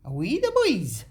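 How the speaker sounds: loud, high mock Geordie cheer; attempted Geordie accent. Having another go at the accent, hammed-up Geordie. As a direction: belt it out in put-on Geordie. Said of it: "can't do that accent".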